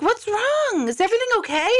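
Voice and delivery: very high mock-concerned nurse voice; imitated nurse. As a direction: pitch it way up as the questioning nurse. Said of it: "now she's like".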